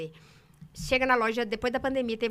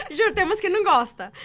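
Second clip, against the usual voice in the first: laughing while talking; 8 semitones higher, 9 dB louder than usual. Real, not acted. Laughs at her own joke.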